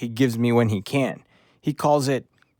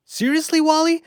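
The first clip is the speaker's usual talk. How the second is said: much higher, louder disbelief; desperate exasperation; 13 semitones higher, 4 dB louder than usual. Acted. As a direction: squeal it like a bursting puppy. Desperate dog wants to pee.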